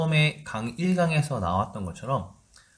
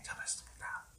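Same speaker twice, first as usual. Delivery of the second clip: whisper; 19 dB quieter, faster than usual. Acted. Teacher whispers praise.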